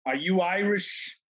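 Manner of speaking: slow, confrontational question, played for laughs; mock pissed-off drunk. Drunk stranger glaring at him on the train, mock menace. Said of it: "he just looked so pissed off at first".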